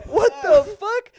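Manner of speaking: high, loud, stretched-out question; retold outburst of incredulity; exasperated disbelief. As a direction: blurt it slowly in utter bafflement. Exasperated reaction to rambling dad.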